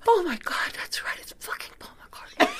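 retold awestruck whisper, almost voiceless; stunned whisper. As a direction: whisper it as if it just dawned on you. Retells a stunned realization.

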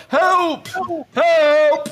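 very high, loud mock cries; the recruits ham up calls for help. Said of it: "They're all".

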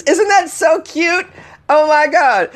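high-pitched, drawn-out cutesy squeal; squealy, over-sweet delight; gushing. Pretend. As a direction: gush like it's adorable. Gushing over own podcast playing.

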